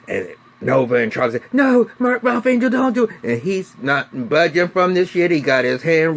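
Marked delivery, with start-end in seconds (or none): 1.5-3.1 high, loud frantic plea; panicked shout of please don't; pleading cry. Retold or imitated. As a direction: beg him to stop, voice way up. Family begging him not to shoot.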